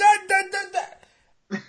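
high loud da-da chant; excited fanfare shout. Retold or imitated. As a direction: herald the friend's arrival. Paul greeting old friend.

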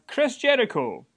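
ordinary talk, nothing marked

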